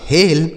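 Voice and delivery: हेल in one beat with long "ay". Shown as a demonstration. Teacher reveals the word, हेल with long e. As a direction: say हेल as "hayl", no glide. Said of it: "यह लिखा है आपका".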